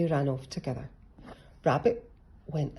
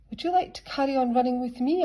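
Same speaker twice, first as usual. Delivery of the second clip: kind offer; 9 semitones higher, 5 dB louder, faster than usual. A narrator's reading. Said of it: "asked Rabbit".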